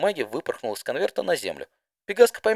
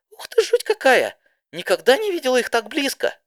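squeaky amazed pony; 6 dB louder than usual. Acted. Didi gasps at the giant trees.

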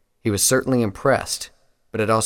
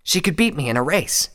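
high, slightly more restrained admission; serious Scarlet; 7 semitones higher, 4 dB louder than usual. A narrator's reading. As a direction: repeat it earnestly, pitch raised. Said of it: "his face now serious".

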